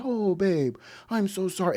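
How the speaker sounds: high sugary fake apology; mock sweet apology. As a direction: coo it like a fake sorry.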